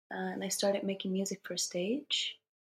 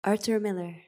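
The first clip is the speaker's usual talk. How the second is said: worn tape copy. Real, not acted. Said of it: "please excuse the audio quality".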